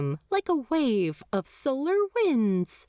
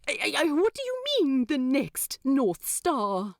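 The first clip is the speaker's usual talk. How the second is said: confused angel; 6 semitones higher than usual. A narrator's reading. Aziraphale baffled by the demon's plan, high-pitched. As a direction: tumble over the words, baffled. Angel figure voiced in story.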